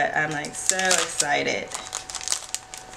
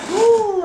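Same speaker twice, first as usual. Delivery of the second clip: excited squeal; 9 dB louder than usual. Real, not acted. Excited squeal opening box.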